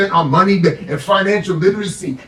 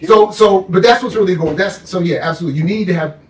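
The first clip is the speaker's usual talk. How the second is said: ordinary talk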